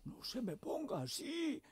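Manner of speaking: high, soft mock voice of the old president; hammed-up falsetto teasing a rival; mocking imitation. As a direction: needle him in a high, coaxing imitation. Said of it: "Y se va".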